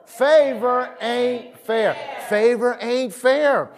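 slow, loud "Favor ain't fair". Shown as a demonstration. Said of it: "Say it louder".

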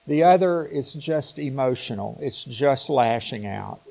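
ordinary talk

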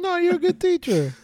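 high, airy mocking teacher praise; high mock reassuring. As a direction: mimic her gushing praise. Mimicking the character's line.

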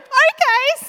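very high, loud retold agreement; hammed-up eager yelp; eager reply. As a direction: act out jumping at the invitation. Acts out her own eager reply.

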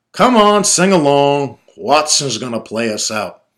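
loud, high-pushed command; growled. Acted. Drunk father urging boys to sing along, loud growl. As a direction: boom it at them. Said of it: "my father growled at us".

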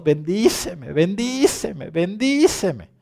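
loud, high, repeated begging; pleading cry. Pretend. Jacob pleads with God, loud and rising. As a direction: cry out for the blessing, climbing. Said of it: "llorando y rogando".

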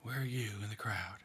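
hushed, half-whispered meditation, leaving long pauses; guided meditation. Acted. Leader guiding a prayerful meditation, in a hushed voice.

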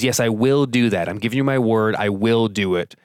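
"will" stressed with a firm fall; firm commitment. Shown as a demonstration. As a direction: promise it with full commitment. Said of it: "Will do that says".

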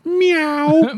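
high loud catcall meow; mocking meow. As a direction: meow at them like a cat. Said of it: "some chick just goes".

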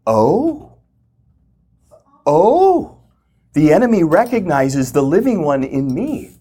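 high, awed oh rising to a cry of discovery; dawning realization. Retold or imitated. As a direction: let the truth hit you mid-word. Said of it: "I'm having this dawning of".

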